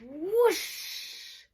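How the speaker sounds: high rushing gust of breath; high whoosh. Acted. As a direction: blow a big gust. Wind sound.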